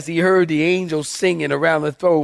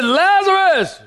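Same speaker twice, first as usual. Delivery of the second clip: high, drawn-out shout of a name; screamed call; 13 semitones higher, 4 dB louder, slower than usual. Acted. Preacher acts Jesus calling into the tomb, screamed. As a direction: holler it as if to wake the dead. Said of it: "So he said".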